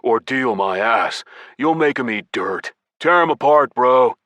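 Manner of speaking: gruff egging-on; growling pep talk. A narrator's reading. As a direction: growl it out, driving up to the finish. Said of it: "Hung growled".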